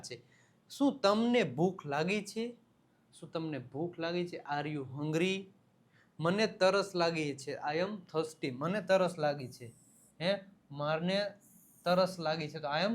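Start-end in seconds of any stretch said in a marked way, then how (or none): none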